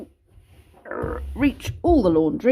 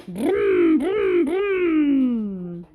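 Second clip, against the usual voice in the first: playful, drawn-out bus engine noise; engine vroom; 3 semitones higher, 4 dB louder, much slower than usual. Pretend. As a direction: make the toy bus roar for the child.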